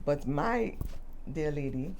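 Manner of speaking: usual speaking voice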